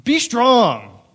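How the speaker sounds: buck them up like a gruff coach, mockingly; mock tough-guy pep talk. Mocking macho advice to a scared friend, loud barking. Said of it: "Isaiah doesn't say".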